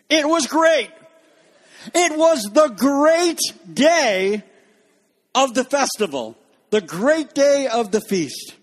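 big booming proclamation of the great day; proclaimed grandly. Shown as a demonstration. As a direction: announce it like a herald, slow and swelling. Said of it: "I can't say it like that".

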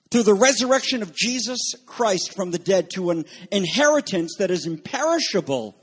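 ordinary delivery

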